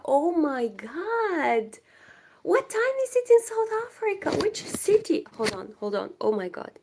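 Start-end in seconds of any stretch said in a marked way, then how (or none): 0.1-5.3 excited squeal. Real, not acted. Squealing excitedly to a viewer from South Africa. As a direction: squeal with delight at a faraway viewer.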